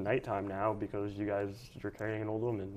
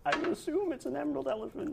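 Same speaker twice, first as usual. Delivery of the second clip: put-on old lady voice. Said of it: "old lady voice".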